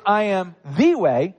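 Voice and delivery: "the way" with "the" pressed and a rise; stress on THE. Shown as a demonstration. Pastor insisting to the congregation, "the" stressed. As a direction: hit "the" as the one and only.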